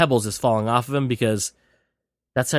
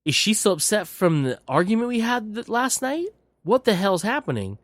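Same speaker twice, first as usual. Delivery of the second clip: put-on confused boyfriend; 8 semitones higher than usual. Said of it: "And he's like".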